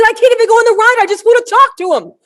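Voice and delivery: high, loud, overwrought pleading; mock breakdown. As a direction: play the friend near tears of excitement. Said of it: "almost had a nervous breakdown".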